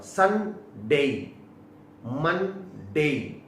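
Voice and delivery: संडे and मंडे said slowly, ending "day"; slow careful days. Shown as a demonstration. Teacher listing weekdays, slow careful संडे. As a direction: draw out संडे, मंडे for the class. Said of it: "सबके बता देता हूं आपको".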